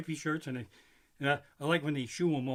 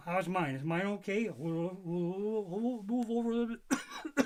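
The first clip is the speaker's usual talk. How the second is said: mock fussy players; 4 semitones higher than usual. Voicing players lining up asses.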